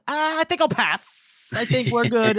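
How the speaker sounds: hammed-up nervous pass; wary character quip. Friends voicing a scared character turning down the meeting.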